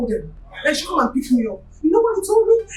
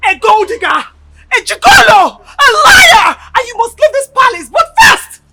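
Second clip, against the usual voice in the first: enraged accusation; 10 dB louder than usual. Acted. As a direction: hurl the insults at full voice. Hurling insults in a quarrel.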